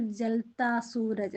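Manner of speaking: ജൽത്താ Sooraj slowly, stress on ജൽത്താ; slow teaching read. Shown as a demonstration. Teacher to pupils, modelling the phrase slowly. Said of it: "എന്താ പറയുന്നത്?".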